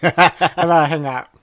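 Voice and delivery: giggling through the words; laughing. Real, not acted. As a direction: laugh while hanging up. Laughing at prank call.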